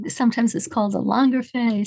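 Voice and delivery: ordinary talk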